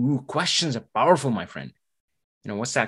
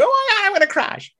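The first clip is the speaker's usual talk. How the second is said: much higher, louder alarmed cry; mock panic; 5 dB louder than usual. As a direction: yelp like the car is crashing.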